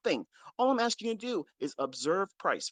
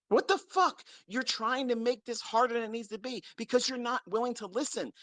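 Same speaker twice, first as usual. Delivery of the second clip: much higher exasperated "What the fuck?"; exasperated outburst; 7 semitones higher than usual. Real, not acted. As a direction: explode in disbelief at the listener.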